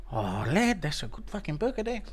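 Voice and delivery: hushed impression of a sneaky food thief; soft, put-on voice, relishing the food; Tuivasa. Guest sneaking a bite at the kitchen counter, quiet put-on voice. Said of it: "you see Tuivasa's head come up".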